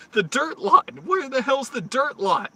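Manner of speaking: high, loud, baffled questions; can't believe the directions; exasperated disbelief. Retold or imitated. Driver baffled by the guard's directions, high and loud. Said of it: "I was like".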